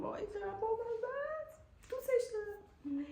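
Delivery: high squeaky gush over a cute unicorn; squealing delight. Real, not acted. Vlogger squealing to viewers over a cute unicorn palette, high delight.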